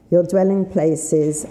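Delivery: usual speaking voice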